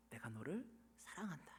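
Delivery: very soft, near-whispered declaration; tender assurance. Acted. God reassuring complaining people of his love, tender. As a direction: let it drop to a hushed promise. God's gentle answer to complaints.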